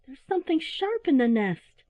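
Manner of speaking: put-on high child's complaint; complaining owlet. A narrator's reading. Hurt owlet telling mother, high complaint. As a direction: yelp it out, pitched high.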